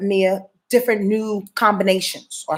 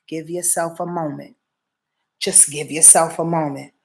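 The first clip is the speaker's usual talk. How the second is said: gentle reassurance; 6 semitones lower, 6 dB quieter than usual. Real, not acted. Gentle advice to the listener.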